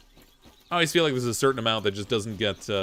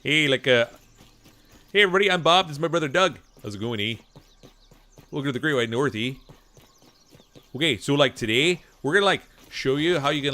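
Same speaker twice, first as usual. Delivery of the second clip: loud, hammed-up Canadian accent; broad hoser Canadian, punchy, with "eh" tags; Strange Brew's Bob; 4 dB louder than usual. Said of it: "copying, [name], with Strange Brew".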